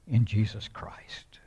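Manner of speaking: slow breathy whisper of the name. Real, not acted. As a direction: drop to a reverent whisper. Hushed close to a verse.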